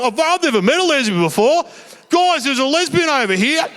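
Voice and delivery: mock-thrilled, calling out to his mates; excited. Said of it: "so he got all excited".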